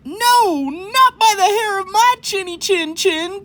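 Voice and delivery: high, loud, defiant call back; defiant javelina call. Acted. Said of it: "called back the third little javelina".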